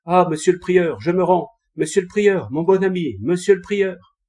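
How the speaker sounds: high, loud pleading yell for mercy; loud cry. A narrator's reading. Archer shouting to the monk, begging for mercy. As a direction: shout up at him, begging to surrender. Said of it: "criait d'une forte voix".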